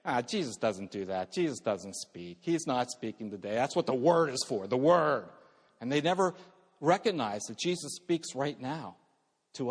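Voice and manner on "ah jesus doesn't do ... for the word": dismissive. Retold or imitated. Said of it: "Christians in name that will say".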